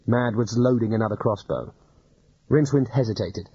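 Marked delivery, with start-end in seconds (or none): none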